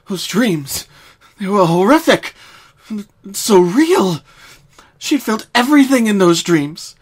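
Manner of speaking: rising, breathless storytelling; tense narration. A narrator's reading. Narrator building dread over Twilight's panic.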